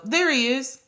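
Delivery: put-on company president spotting the perfect kid, calling out; excited call. A narrator's reading. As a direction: call out as if you've struck gold. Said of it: "he pointed and called".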